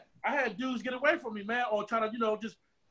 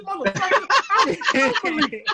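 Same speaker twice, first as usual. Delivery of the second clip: retold outburst; 8 semitones higher, 7 dB louder than usual. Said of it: "I couldn't hold that one".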